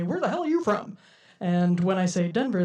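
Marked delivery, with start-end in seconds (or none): 0.0-1.0 mock puzzlement, pitched high; high-pitched incredulous question, put on; imitated baffled question. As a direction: imitate a stranger baffled by the way you talk. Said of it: "A lot of people like to say".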